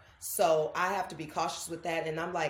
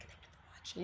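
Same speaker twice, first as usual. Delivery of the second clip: breathy whisper, barely voiced; whispered aside; 15 dB quieter, much faster than usual. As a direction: whisper it so the doctor on the phone isn't interrupted. On phone, mumbling to a fan.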